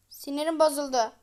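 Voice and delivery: ordinary talk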